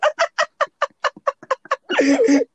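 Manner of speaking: high-pitched burst of laughter. Real, not acted. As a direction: cackle with delight. Big laugh after catchphrase.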